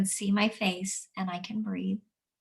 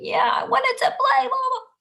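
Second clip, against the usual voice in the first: much higher, louder mock child whine; whiny child; 7 dB louder than usual. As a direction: whine it like a sulky kid who didn't get to play. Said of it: "They're gonna".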